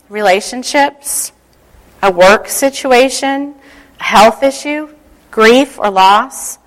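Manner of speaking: guided meditation. Acted. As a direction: ease them into calm, picturing. Leads a visualization prayer.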